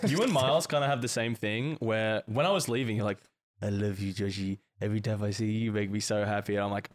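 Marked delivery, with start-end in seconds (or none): 3.6-6.6 low, soft drunken farewell, lightly imitated; death rattle. Imitating a friend's goodbye as he was leaving, mock death rattle. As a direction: mimic a fading friend, low and quiet. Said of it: "this is just like a death rattle".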